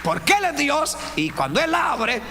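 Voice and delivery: high, loud fervent preaching; full-force preaching. Acted. Preacher urging the congregation, high and loud. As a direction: preach it out with fire.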